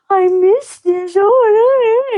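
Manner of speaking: high, wailing fake cry; hammed-up tearful longing; mock weeping. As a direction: wail it like an overly sentimental grandma. Mimicking a sentimental, weepy grandmother.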